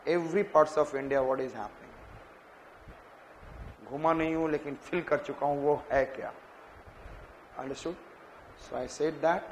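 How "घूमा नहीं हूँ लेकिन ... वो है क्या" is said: usual speaking voice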